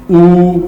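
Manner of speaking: ऊ with lips rounded forward; teacher showing rounded lips; vowel oo demo. Shown as a demonstration. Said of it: "आगे की तरफ".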